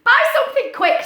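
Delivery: high, loud urgent cat voice; impatient cat character; bossy cat. Acted. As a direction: hurry them along. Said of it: "said the cat".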